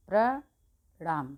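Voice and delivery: प्रणाम drawn out very slowly, प्र blended clearly; slow demo. Shown as a demonstration. Teacher slowly demonstrates प्रणाम for students. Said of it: "प्र के नीचे आएगा ऐसे".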